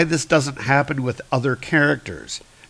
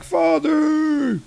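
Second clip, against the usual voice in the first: high, drawn-out mock wail; hammed-up cry. Hercules struck by lightning again, hammed-up cry. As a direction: cry out for father in a much higher voice.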